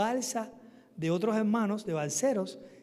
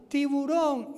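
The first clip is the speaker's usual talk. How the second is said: high, raised warning call; imitated shout; 7 semitones higher, 4 dB louder, much slower than usual. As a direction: shout it like a warning across water. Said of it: "Y nos gritaban".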